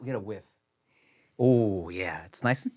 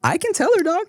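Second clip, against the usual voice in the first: squeaky, pitched-up reply; playful high voice; faster than usual. Pretend. Host offering to ask his mom for the dish, playful high voice. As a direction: pop far up in pitch for a jokey answer.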